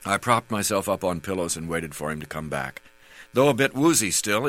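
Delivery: ordinary delivery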